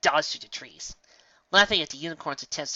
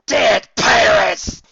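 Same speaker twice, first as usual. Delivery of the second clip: a huge, slow, much louder and higher roar; monstrous shout; 9 semitones higher, 16 dB louder, much slower than usual. Acted. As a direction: bellow it out, dragged and monstrous. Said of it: "shouted the imposters in unison".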